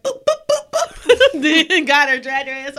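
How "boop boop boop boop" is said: squeaky boop sound effects; high-pitched boops for punches; high mock punches. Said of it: "Bitch was like".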